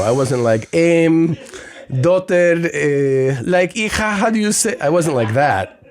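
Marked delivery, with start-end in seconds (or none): 0.7-4.8 high, hesitant mock Spanish-accented English; hammed-up Spanish accent, word by word; fake Spanish accent. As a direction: play a Spanish speaker fishing for English words. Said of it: "now my accent didn't go".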